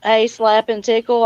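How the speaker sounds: usual speaking voice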